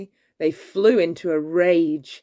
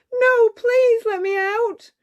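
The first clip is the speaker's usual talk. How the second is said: high, loud, slow begging; pleading cry; 6 dB louder than usual. A narrator's reading. Cinderella locked in, crying high and loud to be freed. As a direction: plead through the locked door. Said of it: "cried Cinderella".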